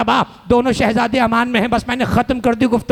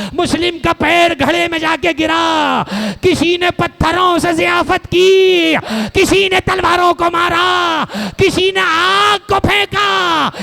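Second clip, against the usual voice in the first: wailing sermon lament; 5 semitones higher than usual. Acted. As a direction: stretch each word out in mourning. Majlis lament at full force.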